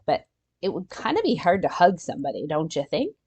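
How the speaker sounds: ordinary talk, nothing marked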